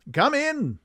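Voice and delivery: high-pitched, very English call; Sherlock Holmes. Acted. Detective answering a knock, high-pitched English voice. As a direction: sing it out high, like an English gentleman. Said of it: "his high-pitched, very English voice".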